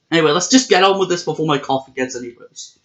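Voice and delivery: ordinary talk, nothing marked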